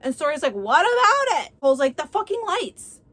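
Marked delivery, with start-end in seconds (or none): 0.5-1.5 high, loud exasperated question; exasperated retort. Acted. Story answers her accuser back, exasperated. 2.0-3.0 angry accusation. Acted. Acted quarrel, Cole accuses Story.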